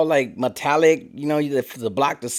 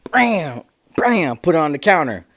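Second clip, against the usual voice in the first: ordinary talk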